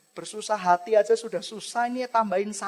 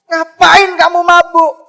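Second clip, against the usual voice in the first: put-on Eli loudly and higher accuses her of being drunk; accusing shout; 8 semitones higher, 16 dB louder than usual. Priest Eli accuses Hana. (Imam Eli membentak dengan keras dan tinggi)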